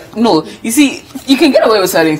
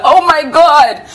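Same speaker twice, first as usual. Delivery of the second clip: higher-pitched mock fan gasp of amazement; crazed excitement; 9 semitones higher, 3 dB louder, slower than usual. Host imitating the whole world's reaction, excited. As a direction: imitate a world going crazy over the news. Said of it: "the whole world went crazy".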